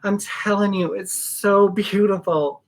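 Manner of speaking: normal talking voice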